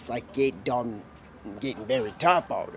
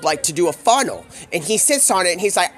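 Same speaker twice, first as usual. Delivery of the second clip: normal talking voice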